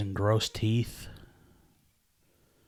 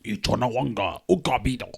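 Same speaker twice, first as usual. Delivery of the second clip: made-up alien gibberish, a bit louder; fake alien language; 4 dB louder than usual. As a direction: mouth a made-up alien tongue. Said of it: "What sounds like an alien language".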